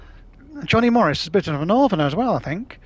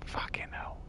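whispered curse; 18 dB quieter, slower than usual. Real, not acted. Muttered exasperation after a mistake.

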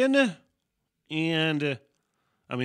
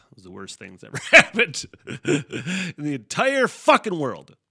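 loud, hammed-up woe broken by laughter; cracking up at his own disaster; laughing exaggeration; 3 semitones higher, 10 dB louder than usual. Real, not acted. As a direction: laugh through the overblown complaint. Mock-exaggerates his blunder while laughing.

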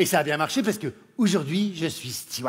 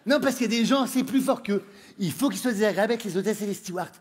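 usual speaking voice

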